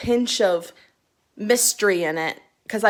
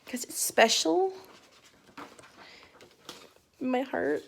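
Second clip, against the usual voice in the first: tender hush; 3 semitones higher, 9 dB quieter, slower than usual. Real, not acted. Unwrapping a special edition.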